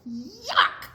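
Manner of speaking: tiny fly squealing yuck. Acted. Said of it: "said the other fly".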